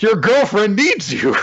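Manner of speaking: high, loud hammed-up cry; mock urgent call. Schemer telling his pal about the girlfriend, loud mock urgency. Gen calls the buddy over urgently.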